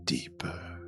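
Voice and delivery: low, hushed ghostly whisper; eerie whispered summons. Acted. Game master voicing the voice Nora hears again, low whisper. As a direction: whisper it like a voice from beneath. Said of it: "you hear that voice".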